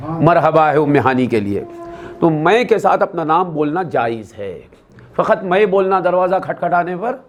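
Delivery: ordinary delivery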